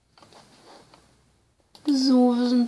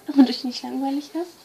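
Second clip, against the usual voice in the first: normal talking voice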